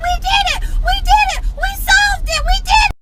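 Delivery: very high-pitched imitated cheering; excited child. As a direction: squeal in triumph like a little girl. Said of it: "she was so excited".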